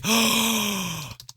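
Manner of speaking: sudden gasp of discovery; sharp gasp. Acted. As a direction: gasp as if shocked. Finds key card.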